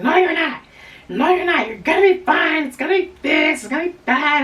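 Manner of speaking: imitating mom brushing off worries, high and firm; mom's voice. Daughter imitates mom dismissing her dread, high-pitched and insistent. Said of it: "my mom was like".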